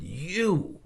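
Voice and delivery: sharp, high, angry "You."; pissed. Retold or imitated. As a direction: spit out "You." at him, annoyed. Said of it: "I was so pissed".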